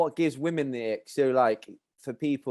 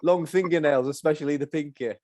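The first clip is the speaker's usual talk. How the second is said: normal talking voice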